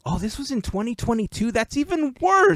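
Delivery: high, shocked disbelief. Real, not acted. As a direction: react in outraged disbelief. Shocked at the date.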